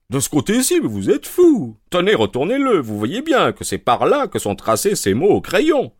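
lightly voiced smug inspector; Lestrade voice. A narrator's reading.

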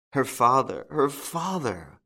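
high, slow moan of grief. Acted. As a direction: let the words sink in despair.

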